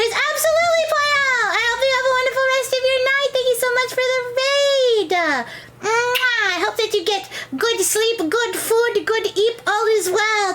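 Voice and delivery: high, fast cutesy cartoon voice; bubbly high sign-off voice; funny high voice. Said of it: "At least the funny voice anyway".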